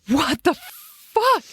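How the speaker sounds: mock terrified outburst; startled cry. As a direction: stretch it out in sudden terror. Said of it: "and he goes".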